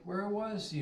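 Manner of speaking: high voiced owner yelling out; lady yelling. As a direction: call out like the worried owner. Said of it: "she yells out".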